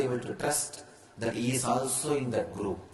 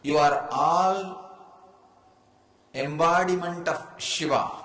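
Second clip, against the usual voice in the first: full-force preaching; 4 semitones higher, 3 dB louder than usual. Acted. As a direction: declare it to the whole hall like a revelation.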